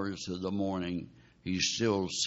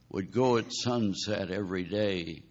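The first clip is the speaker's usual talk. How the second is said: ordinary talk, nothing marked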